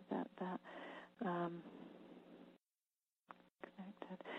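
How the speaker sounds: barely audible whisper to oneself; murmured under the breath while checking. Real, not acted. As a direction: talk to yourself in a faint whisper. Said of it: "if I'm whispering here".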